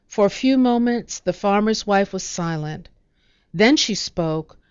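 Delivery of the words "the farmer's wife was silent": usual speaking voice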